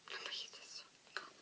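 quick, breathy whisper; a fast, hushed aside. Real, not acted. Repeats the phrase under her breath.

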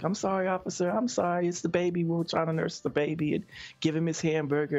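a lightly voiced wife begging the officer; apologetic wife. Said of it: "she was like".

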